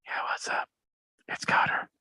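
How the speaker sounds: hushed soldier on deep ops; covert whisper. Pretend. Soldier answers dad's satellite call on deep ops, covert whisper. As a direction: whisper it as if hiding from the enemy. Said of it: "do that thing where he's like".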